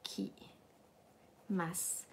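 whispered, breathy repeat; soft unvoiced whisper of the word. Shown as a demonstration. Teacher whispers the word to herself.

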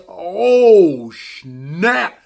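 loud, laughing alarm; excited reaction. Retold or imitated. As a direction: react like a fan watching. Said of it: "I'm just like".

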